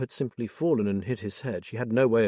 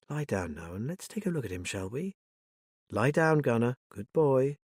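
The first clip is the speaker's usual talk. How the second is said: calm soothing; 2 semitones higher than usual. Acted. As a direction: coax the scared dog down gently. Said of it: "talking calmly to the dog".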